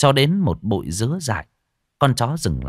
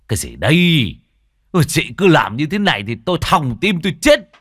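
louder, startled complaint; startled outburst; 7 semitones higher, 6 dB louder than usual. A narrator's reading. Old man startled by the wife tapping his shoulder, louder. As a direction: jump and scold her for the fright. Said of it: "Lão giật mình".

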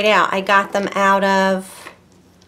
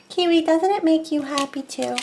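higher sing-song pet voice; pet talk; 7 semitones higher than usual. Real, not acted. Cooing to her pet Kiwi about the pin. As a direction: coo it up to the pet.

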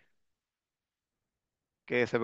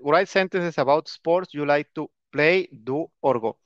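careful slow classroom reading; slow reading aloud; slower than usual. Shown as a demonstration. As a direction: read out slowly word by word.

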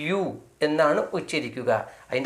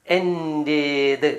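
slow drill; much slower than usual. Shown as a demonstration. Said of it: "എന്തായിരിക്കും അതിൻ്റെ അർത്ഥം?".

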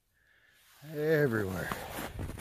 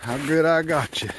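higher and louder, gloating with delight; excited; 5 semitones higher, 6 dB louder than usual. Real, not acted. Trapper talking to the caught bobcat, excited and proud. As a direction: crow over the catch.

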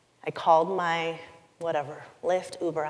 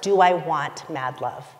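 ordinary talk

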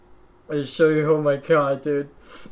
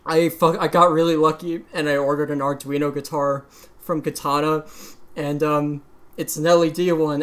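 ordinary delivery

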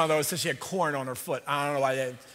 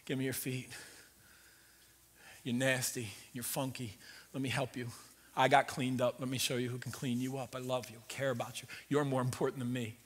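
lower, soft, gentle voice; tender Jesus; 5 semitones lower, 7 dB quieter than usual. Retold or imitated. Jesus gently tells the disciples he will wash their feet, tender and soft. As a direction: speak it like a servant kneeling.

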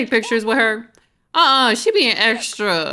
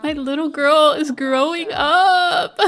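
higher, louder weepy mom voice; mock crying; 6 semitones higher, 5 dB louder than usual. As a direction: imitate a mom tearing up with pride. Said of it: "she was gonna cry".

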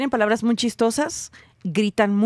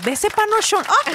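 exclamación burlona, mucho más aguda; suéltalo como piropo grosero, agudo; burla vulgar; 11 semitones higher than usual. Pretend. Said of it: "te pegarían en la boca si dices". (piropo vulgar exagerado, agudo)

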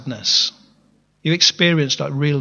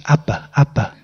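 put-on child's mumbling; 3 semitones lower than usual. Shown as a demonstration. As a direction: mumble it like a small child saying dad. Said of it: "a child's first mumblings".

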